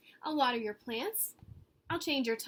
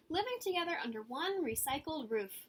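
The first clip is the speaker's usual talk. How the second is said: ordinary delivery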